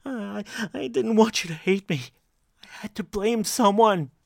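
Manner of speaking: tearful, high-pitched pleading; choked up. Acted. Brooks tearfully excuses himself to his wife, choked up. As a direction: plead through tears, voice high and loud. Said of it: "He choked up, tears streaming down".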